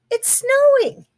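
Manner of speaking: high, bright announcement; delighted reveal. Acted. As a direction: lift it with joy. Big Mouse reveals snow.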